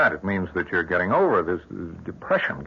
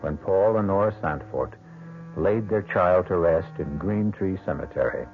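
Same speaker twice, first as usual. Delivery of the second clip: measured, ominous host introduction; old-time radio narrator setting the scene; radio mystery host; 2 semitones higher than usual. A narrator's reading. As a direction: draw the listener into the dark tale.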